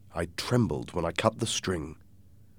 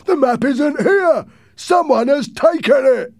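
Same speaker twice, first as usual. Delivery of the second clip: man's shout; 9 dB louder than usual. Acted. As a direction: shout it down from the window. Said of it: "one man shouted from the window".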